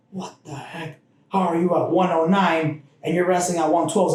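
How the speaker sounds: imitating the coach's shock; a retold what-the-heck; mock disbelief. Coach scolding at weigh-in.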